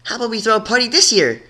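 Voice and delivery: quick high coaxing; bargaining. A narrator's reading. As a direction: coax her, quick and eager. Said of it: "Surprise tried bargaining".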